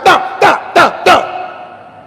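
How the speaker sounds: high, sharp repeated "ta"; imitated blows. Shown as a demonstration. Priest sounds out scripture striking the preachers, imitated blows. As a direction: rap out percussive hits one by one.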